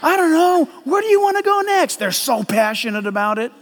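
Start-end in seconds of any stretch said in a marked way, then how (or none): 0.0-2.0 a little higher, rapid excited chatter; mock Middle Eastern chatter. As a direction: imitate animated Middle East talkers. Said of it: "They're talking like this".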